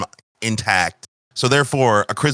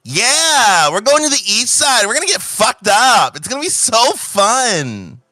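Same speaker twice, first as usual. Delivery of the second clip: put-on high, bubbly party-girl voice; 9 semitones higher, 3 dB louder than usual. Woman telling the knight about the party, high and bubbly. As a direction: gush like a tipsy girl heading out.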